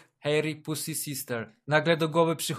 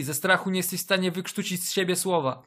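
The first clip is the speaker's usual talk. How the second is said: ordinary delivery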